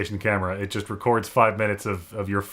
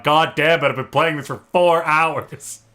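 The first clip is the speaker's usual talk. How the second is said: louder, higher, slow-drawn frustration; exasperated player; 4 semitones higher, 3 dB louder than usual. Acted. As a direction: stretch out the hours in mock outrage. Acting out exasperated puzzle player.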